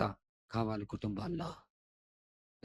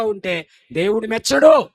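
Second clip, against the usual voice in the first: louder, slow, falling stern warning; 9 semitones higher, 16 dB louder, slower than usual. Acted. Preacher declaring God's disapproval, loud slow warning. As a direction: lay down the verdict slowly. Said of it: "loud take then quiet echo".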